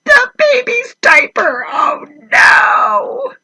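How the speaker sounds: high, shouted mock cry of alarm. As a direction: yelp it like you just caught the smell. Reader reacts to the stink.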